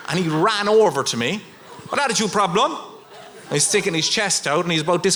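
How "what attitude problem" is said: higher, squaring up, mock tough guy; a hint of a cocky hard man; bouncy castle man squares up to him, mock tough; aggressive comeback.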